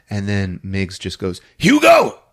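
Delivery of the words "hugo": loud high call for the dog. Acted. Bum summons his Doberman with a loud high call. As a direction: holler the dog's name across the room.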